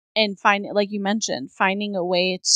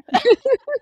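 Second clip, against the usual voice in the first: teary laugh; 4 dB louder than usual. Real, not acted. Laughing about crying.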